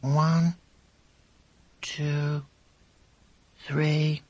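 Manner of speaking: hushed, unhurried counting; drowsy-slow soft count; slow, quiet. A narrator's reading. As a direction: count softly with long gaps. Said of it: "Quite slowly, quite quietly".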